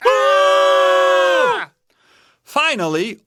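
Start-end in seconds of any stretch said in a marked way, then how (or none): 0.1-1.7 loud, high, drawn-out scream; bears screaming. A narrator's reading. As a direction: scream long in startled alarm. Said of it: "screaming too".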